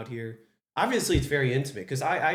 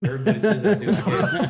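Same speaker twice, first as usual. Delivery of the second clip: put-on laughing, louder copy of a Western-ish accent; mock regional accent; 5 semitones higher, 5 dB louder than usual. Host echoing guest's reply, mock regional accent. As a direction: parrot his regional accent, amused. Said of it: "But it's not Southern".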